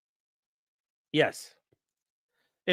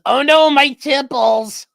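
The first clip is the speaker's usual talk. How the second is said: loud, high, slow fake wail; hammed-up grief over his temples; reading out a chat line in mock anguish; mock despair; 8 semitones higher, 8 dB louder, slower than usual. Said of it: "Nico says".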